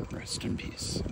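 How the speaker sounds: a choked whisper to his late father; whispered farewell. Real, not acted. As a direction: breathe it out, barely voiced.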